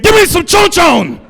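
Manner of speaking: high, loud shouted creepy doll voice; enema voice. Pretend. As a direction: scream it like a possessed toy. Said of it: "it said".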